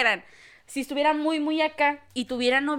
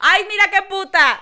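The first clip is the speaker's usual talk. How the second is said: laughing mock insult; 8 semitones higher, 11 dB louder than usual.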